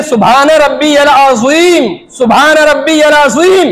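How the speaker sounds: अज़ीम with a clear, lingering ज़ sound; careful recitation. Shown as a demonstration. Teaching worshippers the ruku phrase, careful recitation. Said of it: "जब हम रुकू करते हैं, तो क्या कहते हैं".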